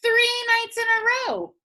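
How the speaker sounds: put-on high indignant old woman; old Granny voice. Granny telling the cow patty about the thief, high and indignant. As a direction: complain like a fussy old granny.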